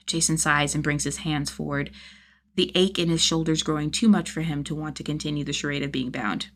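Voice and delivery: ordinary delivery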